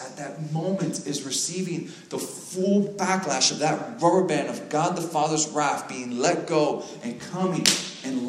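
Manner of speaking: slow, high-pitched preaching that builds in force; impassioned preaching. Real, not acted. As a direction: preach it with mounting intensity.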